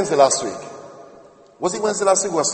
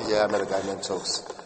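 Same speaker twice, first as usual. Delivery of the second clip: much lower, quieter mock voice of a suspicious woman; imagined woman; 10 semitones lower, 9 dB quieter than usual. Preacher imagines the woman doubting him, low and suspicious. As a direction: drop low and dubious, doubting the guy. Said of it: "I didn't want to have walking around thinking".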